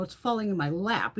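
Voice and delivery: ordinary delivery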